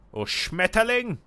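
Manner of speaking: loud, high, slow German shout; shouted German word. Shown as a demonstration. Streamer shouting a German word at chat, loud and slow. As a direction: bellow the word like an angry German. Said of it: "I love how you shout the words".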